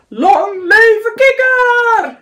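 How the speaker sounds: high, drawn-out loud cheer; cheering shout. A narrator's reading. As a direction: cheer like a whole party of friends. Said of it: "riepen ze". (luid juichend, langgerekt)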